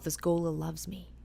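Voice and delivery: soft inner whisper; a woman reassuring herself in her head, whispered; whispered thought. Retold or imitated. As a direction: breathe it as a private thought. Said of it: "she thinks to herself".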